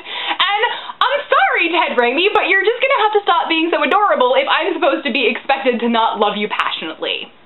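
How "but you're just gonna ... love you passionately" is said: normal talking voice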